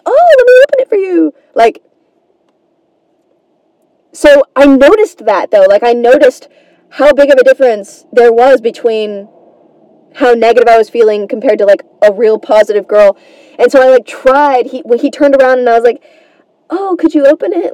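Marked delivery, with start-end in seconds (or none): 0.1-1.3 very high, quick, gushing offer; playful mock-eager helper; obliging guy. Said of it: "And he'd be like". 16.7-17.7 higher, lightly sweetened request; imitated polite, upbeat ask; trying to be positive. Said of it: "I tried to be a little bit more positive".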